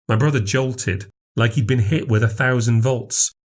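plain reading voice

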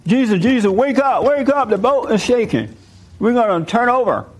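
high-pitched mock panic; freaking out. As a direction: urgently imitate disciples waking their master. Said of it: "And they start freaking out".

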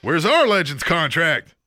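slow booming call, a little lighter; announcer voice. Acted. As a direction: announce the segment title. Repeats segment call.

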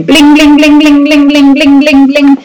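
high, ringing computer chime; rapid-fire run of high-pitched blings; imitated email alerts. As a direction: mimic a computer pinging non-stop.

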